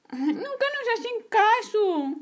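put-on high complaining kid; child grumbling that adults never listen, high; whiny child. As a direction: sulk like a small child ignored.

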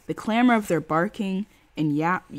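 ordinary delivery, nothing marked

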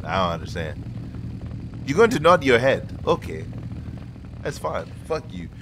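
ordinary talk, nothing marked

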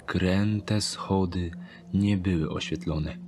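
ordinary delivery, nothing marked

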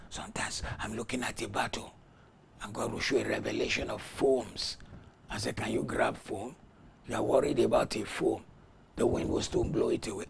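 soft, confiding preacher; hushed testimony. Real, not acted. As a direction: bring it right down to tell the vision. Intimate testimony after the shout.

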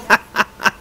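long, high run of laughter; helpless laughing fit. Real, not acted. Player cracking up at the game dialogue, real laughing fit. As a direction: laugh till you cry. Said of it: "j'en pleure de rire".

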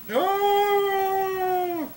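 high hammed-up wailing oh; mock wail. Old man crying on a bench, mock wail.

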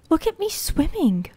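high, delighted cry. Real, not acted. Player swimming for the first time, high and delighted. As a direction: show off proudly.

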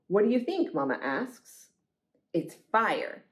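ordinary delivery

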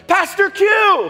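high, loud, drawn-out call across the counter. Retold or imitated. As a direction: shout his name happily across the café. Barista calling out his name.

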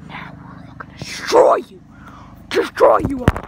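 harsh whispered threat; menacing stage whisper swelling at the end; villain whisper. Acted. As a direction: whisper it like an evil overlord.

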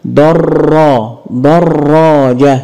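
درا then دراجة slowly, ra doubled; slow shadda demo. Shown as a demonstration. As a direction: stretch دراجة to show the shadda. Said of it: "انطق واعرف مكان الشدة". (دراجة ببطء مع تشديد الراء)

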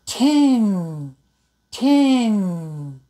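"Ten" with a held nasal n at the end; careful demo word. Shown as a demonstration. Teacher modelling the word ten for students. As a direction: linger on the final n of "Ten". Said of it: "like this".

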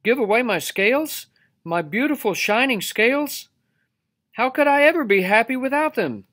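high, loud indignant disbelief; aghast fish. A narrator's reading. Fish aghast at losing scales.

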